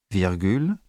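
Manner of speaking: ordinary talk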